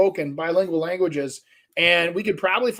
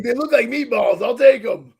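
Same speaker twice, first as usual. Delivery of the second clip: cheerful tourist voice, louder; mock clueless tourist; 5 semitones higher, 6 dB louder than usual. Friend acting the tourist ordering in Poland, mock clueless voice. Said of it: "And you're like".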